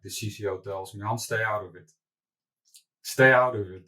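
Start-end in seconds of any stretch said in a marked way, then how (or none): 3.0-3.8 louder, firm mock yell; retold yell. CCO telling the founder to stay out, mock yelling. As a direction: imitate a colleague yelling a warning, half in earnest. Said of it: "he's yelling at me".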